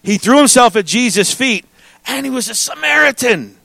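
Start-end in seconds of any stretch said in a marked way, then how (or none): 2.1-3.6 higher, held-out "Samaritan" as a revelation; emphatic reading. Shown as a demonstration. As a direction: read it high, dwelling on "Samaritan". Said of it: "that's how this language should be read".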